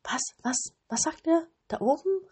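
put-on high, excited girl's questions; eager cry. A narrator's reading. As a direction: call up eagerly to the birds. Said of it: "rief Gerda". (hoch und aufgeregt rufend)

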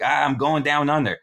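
hammed-up Aussie going under; mock alarmed Australian exclamation; Australian accent. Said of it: "he's fucking hella Australian".

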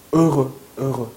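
heureux with rounded lips held in one shape; careful French eu. Shown as a demonstration. Teacher showing students the lip shape of heureux. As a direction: keep the mouth shape fixed through heureux. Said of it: "이 모양이 중요해요".

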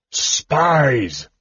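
furious cry of alarm; enraged yell. A narrator's reading. Verminard spots the spies on the balcony, enraged yell. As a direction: roar it out in a rage. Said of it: "yelled Verminard in a rage".

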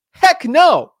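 high, loud heck no; answering his own question, loud and high; emphatic loud refusal. Real, not acted. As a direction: shout it down with total conviction. Rejecting the four-calls idea.

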